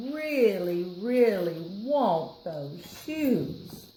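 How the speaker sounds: slow, drawn-out yearning; a child aching for shoes; longing. Acted. Nancy wishing for the shoes, slow and longing. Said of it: "child longing".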